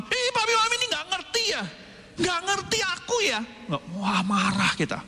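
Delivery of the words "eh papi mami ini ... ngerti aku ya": put-on much higher, fast scolding kid voice; angry child. Child scolding parents who don't understand, high and fast. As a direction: snap at parents like a sulky child. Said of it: "Kita ngomel-ngomel sama papi mami".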